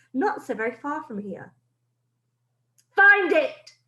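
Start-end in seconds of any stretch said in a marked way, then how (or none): 2.9-3.8 high, loud shrieking command; a witch screaming orders. Acted. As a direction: shriek it at your minions. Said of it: "screams the Grand High Witch".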